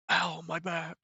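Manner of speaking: botched imitation of Tobey Maguire's whine. Shown as a demonstration. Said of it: "Trying to sound like Tobey Maguire. Fucked it up, though".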